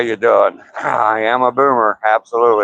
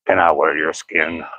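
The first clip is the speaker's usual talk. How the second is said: creepy voice; 6 semitones lower, 3 dB quieter than usual. Shown as a demonstration. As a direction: say it like a lurking stalker. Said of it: "just say in your creepy voice".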